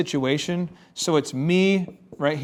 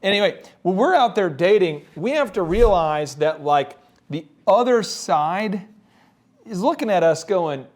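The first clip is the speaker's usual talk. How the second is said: usual speaking voice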